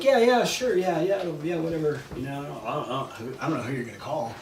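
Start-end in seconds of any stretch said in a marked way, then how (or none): 0.0-2.0 dismissive. Retold or imitated. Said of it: "I was like".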